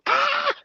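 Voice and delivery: very high squeal of frustration; mad scream. Real, not acted. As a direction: let out a high, exasperated shriek. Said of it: "These people make me so mad".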